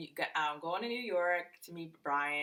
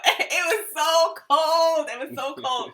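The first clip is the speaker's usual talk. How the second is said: high, loud, drawn-out complaint through a laugh; emphatic exclamation; 11 semitones higher, 9 dB louder, slower than usual. Real, not acted. Girlfriend recalls freezing on the ferry, loud high exclamation. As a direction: stretch it out, exclaiming at the cold.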